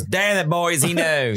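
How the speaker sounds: hammed-up Southern producer, caught out; Southern accent. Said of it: "real thick Southern accent".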